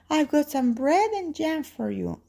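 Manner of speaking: put-on high-pitched little girl voice. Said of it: "she says".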